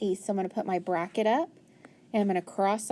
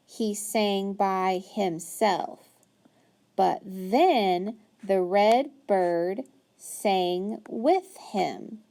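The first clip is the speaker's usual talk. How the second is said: very slow reading, each word drawn out; slow modeled reading; much slower than usual. Shown as a demonstration. Teacher reading aloud to a child, very slow. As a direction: read slowly so the child follows. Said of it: "follow along as I read".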